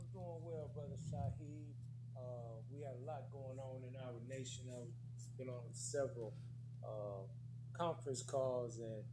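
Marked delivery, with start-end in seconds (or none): 0.0-7.2 quiet, far-off remote caller; faint voice over a bad call; low on the line. Real, not acted. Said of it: "they're just saying very low".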